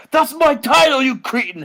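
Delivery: mock outraged Englishman, high and loud; hammed-up British indignation; Steven Regal. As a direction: protest indignantly in a put-on English voice. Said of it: "and he says".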